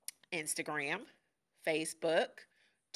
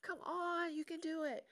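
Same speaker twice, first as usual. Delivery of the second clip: high-pitched coaxing plea; high, urging storybook voices; begging; 9 semitones higher than usual. Retold or imitated. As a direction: plead like eager little siblings, voice pitched up. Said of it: "they're begging him".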